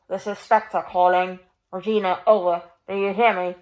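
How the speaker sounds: a distant transmission, muffled; muffled radio voice. Acted. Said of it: "A muffled voice on the other end".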